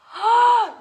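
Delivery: a sharp, high delighted gasp; amazed gasp. Real, not acted. Teacher wowed by the children's crab walk, gasping.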